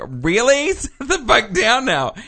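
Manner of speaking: high, indignant disbelief with a laugh in it; exasperated passengers. Retold or imitated. Annoyed passengers to the woman who won't sit, exasperated. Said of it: "And everybody's like".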